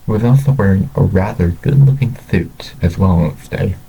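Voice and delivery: ordinary delivery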